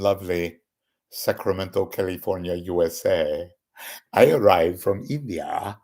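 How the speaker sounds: put-on Indian accent, car salesman pitch. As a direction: imitate an Indian car seller at a dealership. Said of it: "I don't really do Indian impressions, but I'll try".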